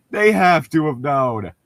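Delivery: lightly put-on villain tone; mock villain voice. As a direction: keep the goofy put-on voice. Continuing high mock voice.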